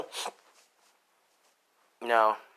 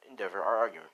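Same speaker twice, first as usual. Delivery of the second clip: usual speaking voice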